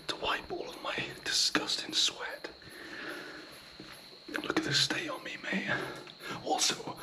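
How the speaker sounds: hushed whisper so no one hears. Real, not acted. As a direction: whisper it, breathy and secret. Whispering vlog at retreat.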